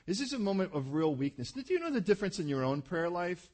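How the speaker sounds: normal talking voice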